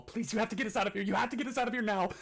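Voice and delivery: high, fast begging rising to a yell; screaming plea, building. Acted. Chained captive begging to be let go, escalating frantic cries. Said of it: "He's getting gradually louder and louder".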